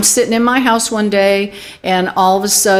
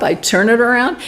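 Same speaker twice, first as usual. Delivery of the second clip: ordinary talk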